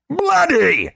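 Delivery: very high, loud exasperated burst. Acted. Globin exasperated with Korash, high loud burst. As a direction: throw up your hands with the word. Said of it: "increasingly exasperated Globin".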